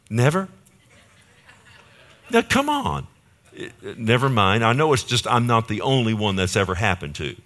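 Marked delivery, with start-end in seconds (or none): 0.1-3.1 high-pitched, incredulous prodding; mock disbelief. Real, not acted. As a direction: coax the silent listeners, pitched way up. Mock disbelief at silent congregation.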